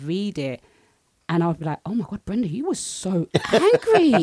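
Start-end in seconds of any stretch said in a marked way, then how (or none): 1.8-4.2 high, loud, slowed teasing gasp; mock shock at herself. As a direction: scold your past self in mock horror. Mocking her old angry writing.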